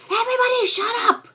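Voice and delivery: loud, high exasperated shout; yelling. Retold or imitated. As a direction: shout down the whole room. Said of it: "I would be like, like".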